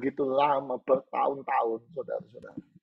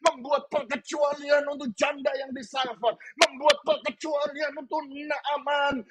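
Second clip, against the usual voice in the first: high, driving sermon voice with rising emphasis; full-force preaching; 10 semitones higher than usual. Real, not acted. Preacher urging congregation to believe in exceptions, full-force preaching.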